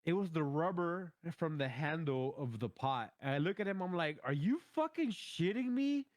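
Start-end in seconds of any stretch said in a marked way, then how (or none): 4.2-6.1 high, slow disbelieving yell; much higher, dragged out in disbelief; incredulous outrage. Retold or imitated. Confronting his brother over the burnt pot, incredulous outrage.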